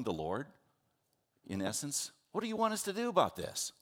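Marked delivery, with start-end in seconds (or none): 2.3-3.7 high-pitched indignant challenge; sarcastic, near mutinous. Acted. Preacher imagines disciples telling off the Lord, sarcastic and defiant. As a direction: throw the question back high, with exasperated attitude. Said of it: "That was their attitude".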